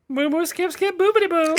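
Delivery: high alien gibberish voice; fake foreign language. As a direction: babble nonsense words in a high cartoon voice. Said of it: "You gave me a fake language to speak".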